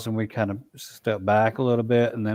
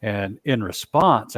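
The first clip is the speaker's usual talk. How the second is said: plain reading voice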